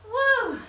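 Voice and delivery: high "woo" after the last rep; finishing-the-set whoop; exertion whoop. Real, not acted. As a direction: let out a high workout whoop. Exhales after finishing last round.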